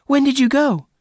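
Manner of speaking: very high, loud demand; horrified. A narrator's reading. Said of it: "Bess asked in horror".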